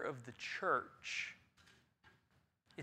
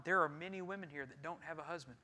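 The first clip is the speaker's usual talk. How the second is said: normal talking voice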